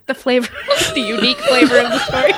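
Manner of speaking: high and giggling all through the words; cracking up at her own pun; laughing. Real, not acted. Repeats the joke while cracking up.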